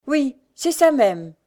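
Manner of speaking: high, loud, drawn-out agreement; approving beggar woman. A narrator's reading. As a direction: agree heartily and slowly.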